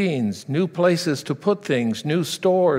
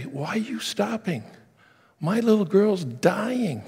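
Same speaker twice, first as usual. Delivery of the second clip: frustrated father; 6 semitones higher than usual. Retold or imitated. Desperate father demanding why Jesus stopped, rising to a shout. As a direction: start hurt and climb to a near shout. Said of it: "can you imagine Jairus' attitude".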